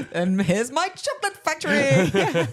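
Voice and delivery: high, drawn-out delivery through laughter; laughing. Real, not acted. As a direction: deliver the joke while cracking up. Joke while cracking up at "Wonker.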